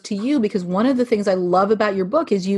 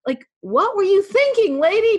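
playful teasing; 10 semitones higher than usual. Real, not acted. Host teasing guest about book.

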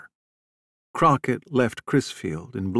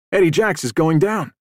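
raised, higher, urgent call; yelling over; 6 semitones higher, 5 dB louder than usual. A narrator's reading. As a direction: call the alarm across to the next boat. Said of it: "Freddie yelled over to Dean Dyes".

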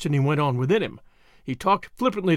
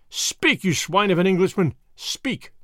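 high-pitched, fierce command; heated, raised-pitch threat; angry demand; 3 semitones higher than usual. Acted. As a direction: demand it of a prisoner, furiously. Boris threatens with fist in face.

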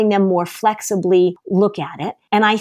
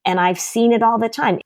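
ordinary talk

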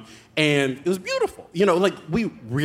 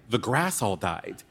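usual speaking voice

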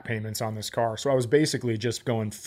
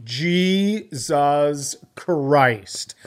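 exasperated; 2 semitones higher, 4 dB louder, much slower than usual. Retold or imitated. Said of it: "And I was like".

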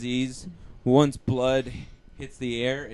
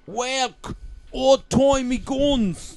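slow, drawn-out mock Australian accent, higher; Australian impression; 7 semitones higher, much slower than usual. Imitating the comic's Australian joke voice. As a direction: ham up a bad Australian accent. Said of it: "How does he do?".

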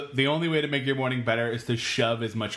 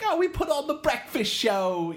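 higher, slower, cutesy mock-British lilt; cutesy storybook British; 10 semitones higher than usual. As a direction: play it like a sweet storybook narrator. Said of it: "sounds like it's coming out of, like, a storybook".

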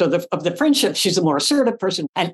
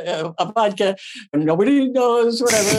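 usual speaking voice

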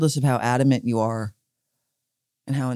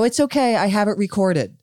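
mock confident reassurance, much higher; 8 semitones higher than usual. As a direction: claim it's recorded, hammed up. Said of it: "you go".

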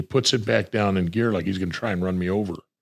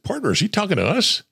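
higher, disbelieving question to the partner; mock disbelief; asking partner in disbelief; incredulous question; 11 semitones higher than usual. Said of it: "I said".

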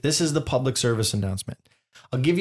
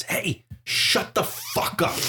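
hissed order; 5 semitones higher, slower than usual. Retold or imitated. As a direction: hiss it at the dog under your breath. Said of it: "and says".

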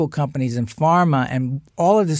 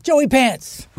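calling a name across a crowd, a little raised; 8 semitones higher than usual. Retold or imitated. Harrison hails him from across the crowd, calling out. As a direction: hail a friend from across the room.

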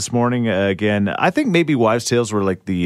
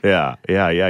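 ordinary delivery

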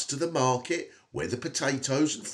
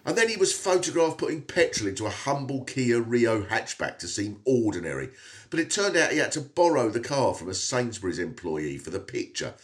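ordinary talk

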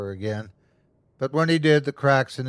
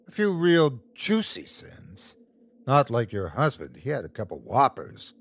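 taunting creature. Acted. Creature taunts the mother about her family, gloating. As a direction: savour it with big pitch swoops.

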